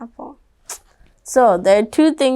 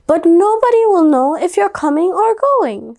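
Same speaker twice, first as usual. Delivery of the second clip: puzzled mom; 6 semitones higher, 3 dB louder than usual. Acted. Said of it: "said her mom".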